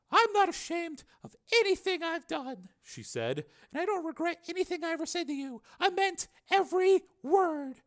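high female voice, deliberate and heartfelt; earnest Susan. Acted. Susan to Steele before leaving, earnest. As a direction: confess it steadily in a high voice.

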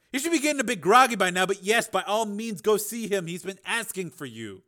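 high, brisk doctor voice; obliging doctor. A narrator's reading. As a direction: send him in to see the patient. Doctor figure answers Steele.

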